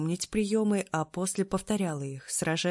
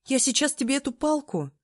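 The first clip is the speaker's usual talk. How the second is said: angry; 8 semitones higher, 3 dB louder than usual. Acted. Heroine threatens trainer.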